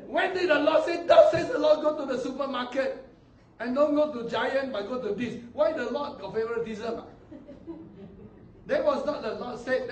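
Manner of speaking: loud, scoffing preacher outburst; loud scoffing rebuke with laughter; loud mocking rebuke. Real, not acted. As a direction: ridicule the claim at full voice.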